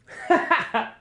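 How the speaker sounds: short scornful laugh; mocking laugh. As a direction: laugh at the little guy. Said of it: "everybody just kinda laughs".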